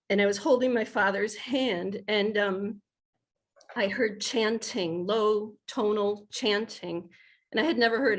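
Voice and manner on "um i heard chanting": ordinary delivery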